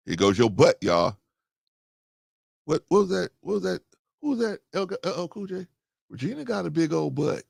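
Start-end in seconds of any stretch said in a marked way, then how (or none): none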